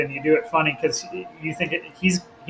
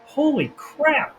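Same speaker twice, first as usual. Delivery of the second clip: slow, much higher gasp of amazement; amazed exclaim; 10 semitones higher, much slower than usual. Retold or imitated. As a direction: stretch it out, higher, like you can't believe your eyes. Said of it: "It was like".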